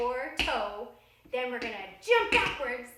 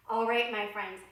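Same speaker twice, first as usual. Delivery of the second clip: ordinary talk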